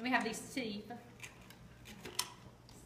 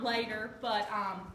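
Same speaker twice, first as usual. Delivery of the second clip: ordinary talk, nothing marked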